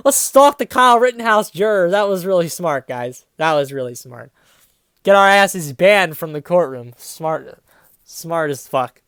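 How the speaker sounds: loud mocking praise that drops into dry scorn; sarcastic. Real, not acted. Reader mocking the reporters' dumb idea, sarcastic.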